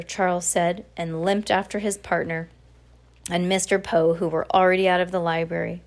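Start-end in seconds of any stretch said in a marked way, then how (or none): none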